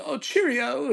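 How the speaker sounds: high, chirpy mock British greeting; fake British accent. Fake Brit wandering round work, chirpy British accent. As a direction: sing out a cartoon British pleasantry, pitched high. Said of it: "this guy with the fake British accent".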